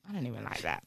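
quiet aside. Retold or imitated. Retold reply, very soft.

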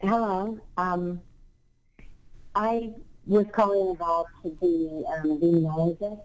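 distant, roomy phone sound; on speakerphone. Real, not acted. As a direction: caller on speakerphone, far from the mic. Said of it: "I think you're on speakerphone right now".

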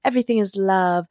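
lightly voiced airy sing-song spiritual type; mock serene priestess. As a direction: tease the blissed-out self, la la la. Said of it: "I'm kind of making fun of them".

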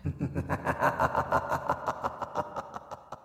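low whispery villain cackle; evil cackle. Acted. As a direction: cackle darkly into the mic. Host's laugh after intro.

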